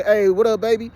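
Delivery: lifted, casual street greeting; casual Detroit greeting. Shown as a demonstration. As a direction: greet a pal Detroit east-side style. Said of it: "if he had said it like that".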